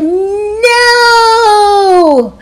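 put-on high, loud stubborn child's no; defiant shout. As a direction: yell it like a kid refusing. Thomas refuses again, louder.

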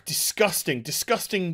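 usual speaking voice

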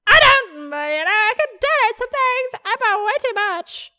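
very high, squeaky-light voice; high character voice. Acted. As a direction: pitch it way up for the character.

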